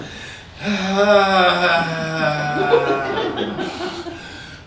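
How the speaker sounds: drawn-out dying-animal moan of a breath; a moan that wakes the dogs; long groaning exhale. Retold or imitated.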